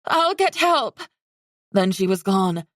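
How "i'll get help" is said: high urgent shout. Acted. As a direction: call it out as you run. Talon rushing off.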